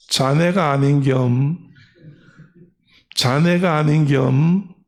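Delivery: slow, drawn-out Chungcheong dialect. Retold or imitated. Grandmothers tugging a skirt to query her, slow Chungcheong dialect. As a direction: imitate an unhurried Chungcheong villager. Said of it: "충청도 사람들이 이래요".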